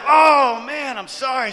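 exaggerated retold apology; dismayed apology. Acting his own dismayed apology.

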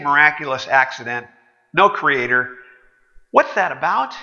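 usual speaking voice

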